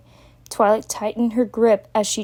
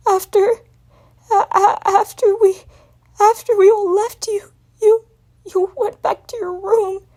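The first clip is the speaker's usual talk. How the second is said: high, stammering crying voice; gasping sobs; 12 semitones higher, 4 dB louder than usual. Acted. Spike gasping out his dream to Twilight. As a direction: sob between every broken word. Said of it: "breathing in rapid gasps".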